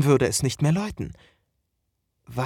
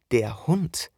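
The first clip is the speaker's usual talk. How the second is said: ordinary delivery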